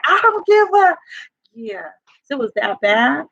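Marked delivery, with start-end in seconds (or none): 0.1-1.0 high, loud, defiant snap; defiant retort. Retold or imitated. Teen yelling back at her mama, high and defiant. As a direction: fire back at mom mid-argument. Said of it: "and I'm like".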